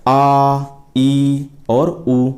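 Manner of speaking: आ, ई and उ each stretched very slowly; long vowels held double length. Shown as a demonstration. Teacher demonstrating long vowels for students, drawn out. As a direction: draw every vowel out twice as long as a short one. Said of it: "दो गुना समय लगता है".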